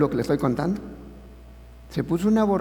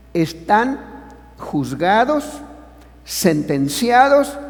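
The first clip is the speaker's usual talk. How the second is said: slow, rising and louder on each word; emphatic; 4 semitones higher, 3 dB louder, much slower than usual. Acted. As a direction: hammer each word home. Predicación enfática, palabra por palabra. (cada palabra más lenta y más fuerte)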